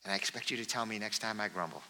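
soft, kind nudge to a good friend; gentle friendly correction. Shown as a demonstration. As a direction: quietly say it like a caring buddy.

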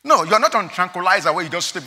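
normal talking voice

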